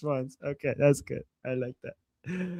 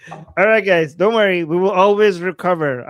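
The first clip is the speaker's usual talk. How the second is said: mock rally speech; 9 dB louder than usual.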